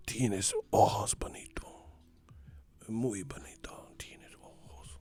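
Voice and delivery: soft romantic whisper in Spanish; seductive whisper. Retold or imitated. Simba romancing Nala in the dub, whispered. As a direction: breathe it like a seductive lover.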